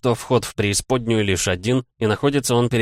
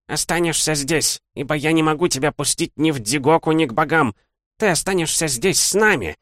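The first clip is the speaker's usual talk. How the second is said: put-on old hag voice; 8 semitones higher than usual. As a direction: sneer like an ancient hag. Old demon hag speaks. (голос злобной старухи)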